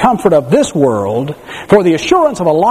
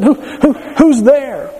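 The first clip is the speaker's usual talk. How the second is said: put-on higher, stammering scared little girl; frightened little girl; 8 semitones higher, much slower than usual. Little girl calling into the dark cellar, frightened. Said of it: "it frightened her".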